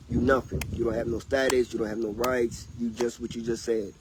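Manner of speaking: usual speaking voice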